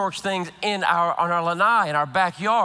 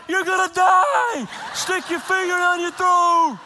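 frantic yell; 10 semitones higher, 3 dB louder than usual. Retold or imitated. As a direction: yell as if her life depends on it. Retold yelling at wife.

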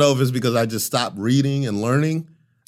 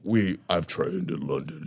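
mock posh actor; 4 semitones lower, 8 dB quieter than usual.

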